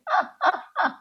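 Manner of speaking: high, thin teasing giggle; mocking schoolgirl laugh. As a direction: sneer out a short cruel laugh. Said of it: "se burlaban feo".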